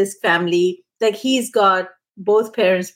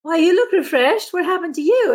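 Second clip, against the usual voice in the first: surprised; 9 semitones higher than usual. Retold or imitated. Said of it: "I said".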